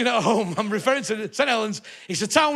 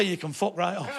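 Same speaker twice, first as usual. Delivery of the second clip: quiet retort; 4 semitones lower, 7 dB quieter than usual. Retold or imitated. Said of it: "I'd say".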